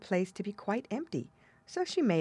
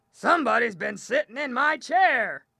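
loud, higher-pitched bear growl; growling big bear; 6 semitones higher, 7 dB louder than usual. A narrator's reading. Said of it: "the big bear growled".

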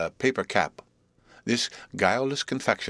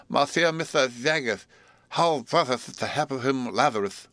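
excitable pleading suitor; 5 semitones higher than usual. Acted. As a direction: beg him with a lisping eager voice.

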